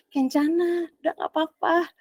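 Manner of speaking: lightly mocking, soft coaxing "it's okay" voice; mock soothing. World soothing Kencana again.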